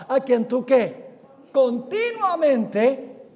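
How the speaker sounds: CONTINUAMENTE very slow and loud, swelling on "ti"; stress on CONTINUAMENTE. Shown as a demonstration. Preacher corrects his misquote for the congregation, stress on CONTINUAMENTE. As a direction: prompt with "qué", then stretch out "continuamente". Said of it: "No, no, no".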